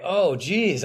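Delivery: high, loud "Oh, geez" of being overwhelmed; overwhelmed gasp. Retold or imitated. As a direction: blurt it out, caught off guard by the emotion. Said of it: "now I'm like".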